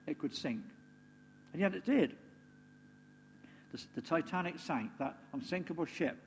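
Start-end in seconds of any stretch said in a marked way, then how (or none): 3.7-6.2 whispered. Real, not acted. Solemn turn to the sinking.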